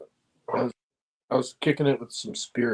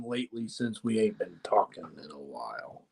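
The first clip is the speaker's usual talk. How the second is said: whispering; 9 semitones lower, 8 dB quieter than usual. Real, not acted. Host drops voice to ask group.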